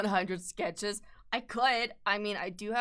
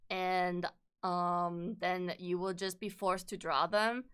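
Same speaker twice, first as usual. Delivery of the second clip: ordinary talk, nothing marked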